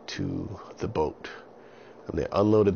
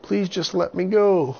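put-on very high, loud frightened boy; scared boy voice; 11 semitones higher, 6 dB louder than usual. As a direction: plead like a panicked little boy. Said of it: "And Avon said".

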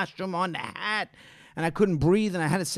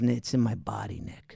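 low, soft actorly murmur; imitated co-actor John; 10 semitones lower, 7 dB quieter than usual. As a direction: drop low and hushed, confiding like a serious actor. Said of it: "He goes".

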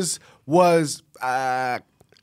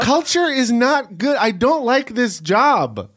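high, louder prim voice; mock prissy objection; 10 semitones higher, 3 dB louder than usual. Mocking the workplace culture, high prim voice. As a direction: act the offended prude up high.

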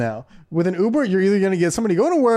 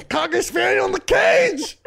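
high, slow shouted punchline; hammed-up sexy line; mock pickup shout; 14 semitones higher than usual. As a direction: belt out the punchline. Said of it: "Let me put this".

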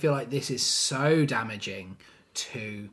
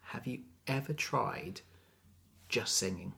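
quiet quoted suggestion; 2 semitones lower, 8 dB quieter than usual. Retold or imitated. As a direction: imitate offering tactful advice, softly. Quotes gentle feedback to diva.

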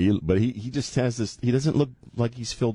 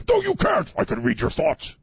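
high, eerie threatening voice; creepy high woman voice; 13 semitones higher than usual. Acted. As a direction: taunt him in a high voice. Acting the fiancée.

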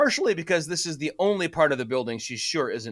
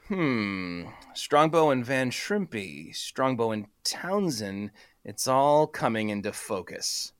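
scheming murmur; 5 semitones lower, 4 dB quieter, slower than usual. Acted. Sylvia musing, scheming aloud.

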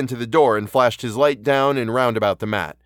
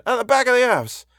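high, frightened constable's voice; alarmed; 12 semitones higher than usual. A narrator's reading. Policeman explaining what lies behind the house, alarmed. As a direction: finish it in rising alarm. Policeman backs away from the horror.

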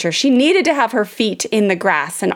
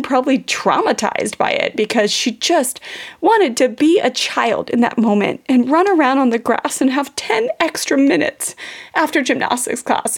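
ordinary talk

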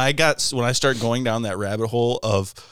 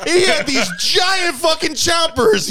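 much higher, louder, half-crying mock shout; Todd freaking out; 6 dB louder than usual. Friend recalling plumber's huge teeth, shrill mock yell. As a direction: wail it like a laughing rant. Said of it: "He's like".

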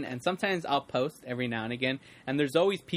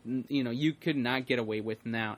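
ordinary delivery

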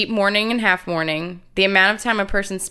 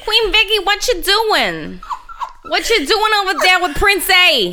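put-on New Jersey accent; 10 semitones higher than usual. Co-host teasing Queen Victoria in a New Jersey accent. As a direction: ham up a Jersey accent, pitched high. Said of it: "your Jersey accent".

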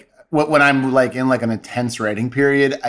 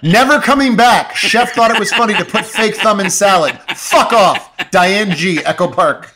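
angry reviewer; 7 semitones higher, 4 dB louder than usual. Pretend. Reading an angry Yelp review.

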